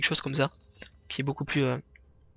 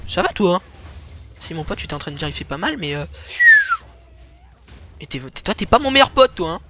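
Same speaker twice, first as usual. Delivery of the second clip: loud, high playful ribbing; mock the teammate playfully; teasing; 4 semitones higher, 7 dB louder than usual. Real, not acted. Teasing his teammate on the lane.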